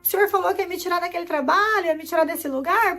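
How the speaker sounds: high, distraught questioning, acted out; exasperated pleading, pitched well up; retold tearful outcry. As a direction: re-enact a desperate cry to God. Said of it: "eu comecei a chorar, falei".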